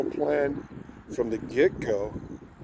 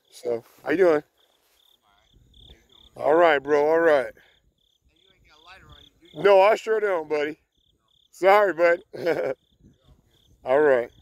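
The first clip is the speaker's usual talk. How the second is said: raised friendly call across the street; 3 semitones higher, 6 dB louder than usual. Real, not acted. Calling out loudly to a passing buddy. Greeting a passerby outdoors.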